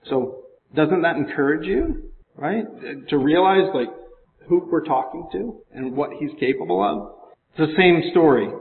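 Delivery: ordinary talk, nothing marked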